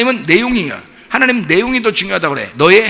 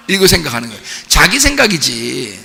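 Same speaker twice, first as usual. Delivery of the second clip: usual speaking voice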